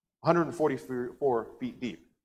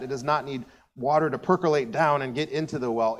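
ordinary talk

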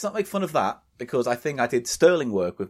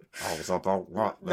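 slow, low, softer creepy catchphrase; Jimmy Savile; 5 semitones lower, 5 dB quieter, slower than usual. Acted. Heard at night in a dark hospital ward, slow creepy impression. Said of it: "That's terrifying. If you were at night".

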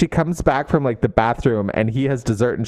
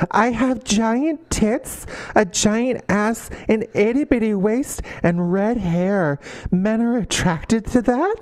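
higher, slow put-on voice of a vain heroine; mocking a vain character; 8 semitones higher, slower than usual. Mocking the Knitting Circle heroine, in a slow, higher put-on voice. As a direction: drag it out like a clueless bombshell. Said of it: "Knitting Circle woman that's like".

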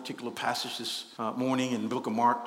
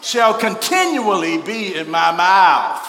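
louder, slow, drawn-out reading; loud congregational recital; 4 semitones higher, 10 dB louder than usual. Shown as a demonstration. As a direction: lead the room in saying it. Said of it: "Come on, so, so".

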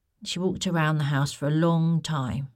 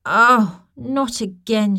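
loud fed-up groan; complaining; 6 semitones higher, 8 dB louder, slower than usual. Acted. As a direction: moan it, fed up. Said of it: "she complained".

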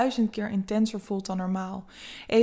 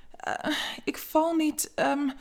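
slow, higher, halting stammer; stuttering; 7 semitones higher, slower than usual. Acted. Eva stammering, startled after Vera's kiss. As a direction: falter and stall over each word. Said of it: "stottert ze".

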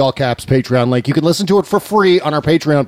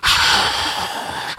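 long hiss of static; unvoiced rush of cell noise, imitated; imitated bad phone signal; 5 dB quieter than usual. Host mimicking a guest's awful cell connection, hissing static. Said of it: "because it was just like".

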